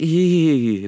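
high, drawn-out squeal; grossed-out squeal. Shown as a demonstration. Teacher demonstrating reaction to something gross, shocked. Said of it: "like, gross".

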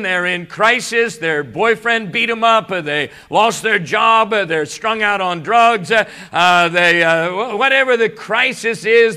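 preaching. Acted. Preaching builds in intensity.

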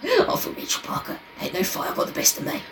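hushed, rough tough-guy impression; Phil Mitchell. Echoing co-host's Parker joke as soft Phil Mitchell.

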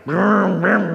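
imitated engine revving; mock motorbike engine noise; motorcycle vroom. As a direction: rev it like an approaching motorbike. Motorcycle arriving in the story.

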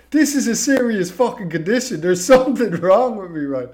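loud, laughing indignant protest. Retold or imitated. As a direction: vent the outrage, half laughing. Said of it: "pisses me off".

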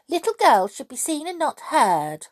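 put-on high, scolding horrid old woman. A narrator's reading. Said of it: "in a horrid kind of voice".

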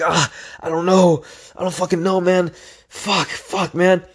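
high, strained swearing to himself; acted-out despair. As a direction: relive the panic of laps still left. Acting out self-doubt mid-run.

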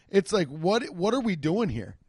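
ordinary talk, nothing marked

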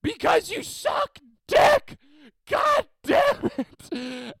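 full-force high scream, drawn out; very loud, slow enraged bellow; exploding coach yell. Retold or imitated. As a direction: explode at the team word by word. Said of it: "my coach explodes, the loudest".

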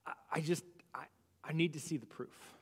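hesitant unvoiced whisper. A doubting believer asking God for proof, whispering. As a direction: breathe it out, unsure.

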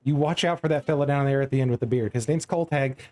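put-on confiding Southern accent; Maribel. Tavern keeper warning customer about strong drink, Southern accent. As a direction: caution them in a folksy Southern accent. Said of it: "this Maribel is Southern".

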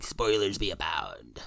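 slow, hammed-up pirate voice; spoiler pirate. Joking host warning of spoilers, playful pirate voice. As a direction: growl it out like a joke pirate. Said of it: "you're not getting the spoiler pirate".

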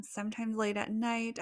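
normal talking voice

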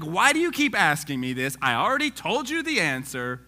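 loud, exasperated retort; fed up with being asked again; upset Peter. Retold or imitated. Peter snapping back at Jesus, loud and exasperated. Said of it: "He is mad".

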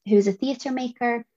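ordinary talk, nothing marked

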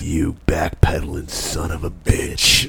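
slow, drawn-out tough-guy insult; old movie villain voice. Pretend. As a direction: drag it out like a hammy old film heavy.

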